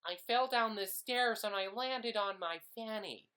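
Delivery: high, loud hammed-up American actor voice; American accent. Pretend American actor auditioning, loud high American accent. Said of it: "It's my, um, audition piece for, for any American".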